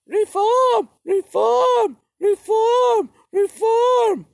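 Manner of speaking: very high, loud squeal of reform; mock Catherine the Great. Comic narrator mocking Catherine the Great, very high and loud. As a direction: shriek it like a giddy empress. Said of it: "She also, too, was like".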